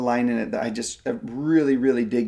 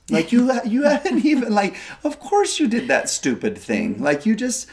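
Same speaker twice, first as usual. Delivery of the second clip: much higher, laughing, fondly reassuring the Lord; the Lord speaking gently; 9 semitones higher than usual. Retold or imitated. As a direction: voice the Lord kindly comforting a child, pitched high with a laugh. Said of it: "I feel like the Lord's really saying to me".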